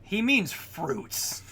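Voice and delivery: a lightly voiced officer clarifying, drawn out; mock explainer. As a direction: spell out the pun, slowly and high. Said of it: "he's like".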